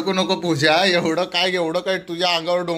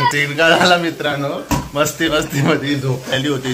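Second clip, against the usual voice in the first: laughing to viewers; 6 semitones lower than usual. Real, not acted. Tells viewers while laughing.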